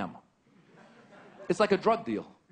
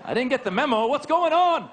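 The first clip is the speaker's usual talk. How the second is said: 1 mock panic; 10 semitones higher, 5 dB louder than usual. Mock panicked guilty confusion.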